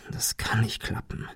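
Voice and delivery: whispered aside, under the breath; to himself. Acted. Ben muttering to himself at the satellite phone, whispering. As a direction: whisper it to yourself, doubting. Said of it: "mehr zu sich selbst".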